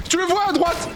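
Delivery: very high, urgent squeal; high-pitched gamer panic; alarmed cry. Real, not acted. Urgent cry to teammate mid-game.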